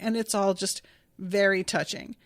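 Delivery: ordinary talk, nothing marked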